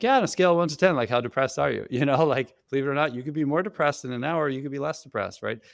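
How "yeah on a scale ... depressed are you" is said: cheery therapist. Retold or imitated. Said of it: "And I'd be like".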